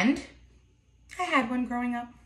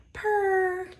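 lion purr; 8 semitones higher than usual. Acted. Lion can also just purr.

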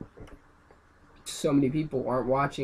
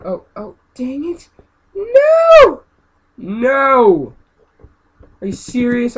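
very high, loud screams of no; real raging yell; 10 semitones higher, 15 dB louder, much slower than usual. Real, not acted. Gamer losing the level, raging screams. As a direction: scream at the screen. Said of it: "this is me raging".

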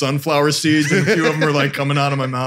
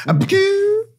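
laughing mock Puget; slower than usual. Real, not acted. Mocking the car name.

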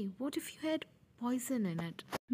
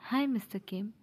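plain reading voice

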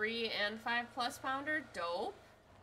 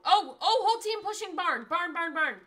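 loud, much higher urgent shout; alarmed yell; 8 semitones higher, 13 dB louder than usual. Real, not acted. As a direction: scream the warning to the team. Alarmed callout of enemy push.

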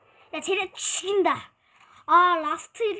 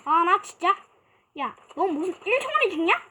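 ordinary talk, nothing marked

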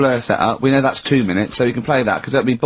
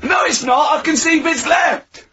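shouting; 12 semitones higher than usual. Real, not acted. Berating Carl's burger attempt.

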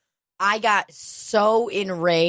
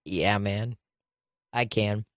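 flat reluctant reply; 8 semitones lower, 4 dB quieter than usual. Retold or imitated. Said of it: "I was just like".

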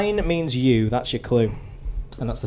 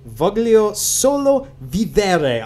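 slow, higher, drawn-out Italian reading; Italian accent; 7 semitones higher, much slower than usual. Shown as a demonstration. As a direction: read it out slowly in amateur Italian. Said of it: "This next one's Italian".

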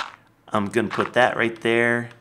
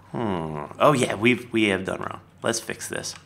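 ordinary talk, nothing marked

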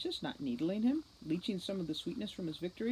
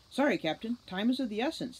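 higher, louder brisk sprite; 7 semitones higher, 5 dB louder than usual. A narrator's reading. Sprite rushing Holly to the commander, brisk and louder. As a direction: hurry her along, no time to spare.

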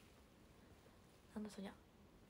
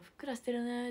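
quoted hurtful remark; 3 semitones higher, 6 dB louder than usual. Retold or imitated. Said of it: "って言われたら全然嬉しくない".

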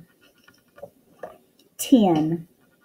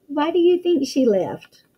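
normal talking voice

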